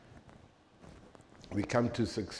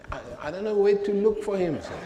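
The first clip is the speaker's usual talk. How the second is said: higher, fast, nervous stammering with a laugh; mock the panicked clerk; flustered stammer; 9 semitones higher, faster than usual.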